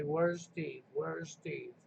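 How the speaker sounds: quiet mocking fans. Vlogger mocks viewers asking after Steve, soft low mimicry. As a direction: mutter the fans' question low and quiet, mocking. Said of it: "I see all these people constantly".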